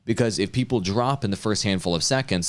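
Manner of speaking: ordinary delivery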